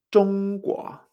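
ZHONGGUO, primera sílaba alta y plana, segunda ascendente; mantén ZHONG arriba y sube en GUO; tono uno dos. Shown as a demonstration. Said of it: "El tono uno dos". («zhongguo»: tono alto y luego subida)

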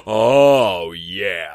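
deep, drawn-out "oh yeah"; low drawn-out awe. Retold or imitated. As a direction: let it sink low and stretch out, savoring it. Retelling own awed first reaction.

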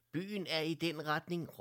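a bright calling shout, pitched high; called out. Acted. Doctor Clara points the bears toward town, calling out. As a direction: call out the way to the others. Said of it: "råbte doktor Clara".

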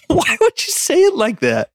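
high, laughing question; laughing disbelief. Real, not acted. Mocking his odd phrasing, laughing. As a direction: ask it through laughter. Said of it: "no worse way to say that".

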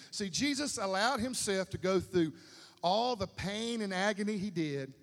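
loud, insistent climax of the sermon; loud, passionate pulpit delivery; fervent preaching. Acted. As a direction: hammer the point home to the church.

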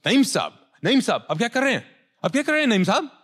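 high, loud and hurried Urdu plea; Urdu, high and loud, as the servant begs his master to move; alarmed pleading. Retold or imitated. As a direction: urgently beg him to stop. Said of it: "This is what he actually said".

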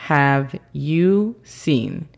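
very slow careful English; teacher demo, very slow; slow model phrase. Shown as a demonstration. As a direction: drag each word for learners. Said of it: "La pronunciación fonética de esta sería".

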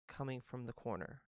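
usual speaking voice

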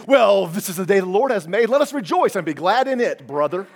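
mock Southern churchgoer reciting scripture. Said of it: "turn into a southern redneck".

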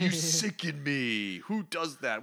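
acted comic-book villain berating a weakling; mock disgusted scolding. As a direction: draw out the disgust, hammed up.

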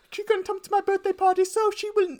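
very high mock British queen accent, breaking into laughter; a hammed-up high British queen voice. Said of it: "terrible British accent".

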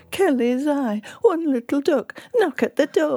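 bingo caller. Retold or imitated. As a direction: call the numbers like a bingo hall caller. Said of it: "and they're calling out".

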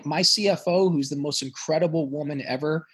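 ordinary delivery

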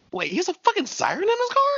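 high-pitched incredulous outburst; imagine them baffled, pitch shooting way up; mock disbelief. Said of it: "y'all just over here like".

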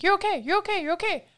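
high, hurried you're-okay chant; dismissive soothing. Retold or imitated. Parent brushing off an upset child's feelings, high bright soothing. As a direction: pat the feeling away with a bright sing-song. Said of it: "I'm saying".

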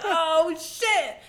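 high, loud, startled "oh shit"; mock shock. The travelers realizing who the Abhorsen is, a high startled yelp. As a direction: yelp it like caught off guard.

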